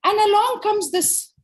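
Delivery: high-pitched mock indignation; indignant wolf. Wolf tells of the girl entering his woods, indignant.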